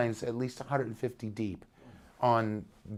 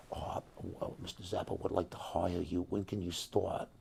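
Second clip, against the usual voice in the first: soft, low imitation of a manager; hushed, understated manager voice; low-key retold offer; 5 semitones lower, 8 dB quieter than usual. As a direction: murmur the offer low and casually.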